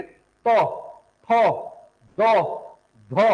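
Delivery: तो, थो, दो, धो said singly, tongue on the teeth; dental sounds. Shown as a demonstration. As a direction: demonstrate तो, थो, दो, धो as separate dental syllables. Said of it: "तो बोलने में जीभ कहां लग रही है".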